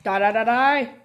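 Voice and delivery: loud fierce chant of die; aggressive yell. Real, not acted. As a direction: yell it at the enemy. Shooting a monster.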